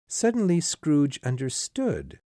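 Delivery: plain reading voice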